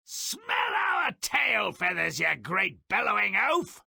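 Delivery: high shouted taunt. Acted. Goose taunting the giant while fleeing, high shouted jeer. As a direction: jeer at the giant as you flee.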